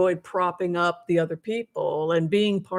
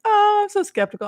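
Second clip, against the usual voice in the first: high, loud mock-skeptic voice; squeaky sarcastic imitation of a doubting sister; 14 semitones higher, 6 dB louder than usual. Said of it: "she's just like".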